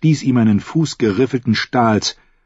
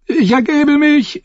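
high, slow squealing cry; screamed surrender; 4 dB louder than usual. A narrator's reading. The fool surrenders to Brienne, high-pitched screaming. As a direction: shriek your surrender like a fool. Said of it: "schrie der Narr".

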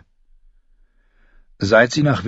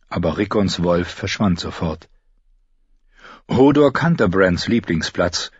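ordinary delivery

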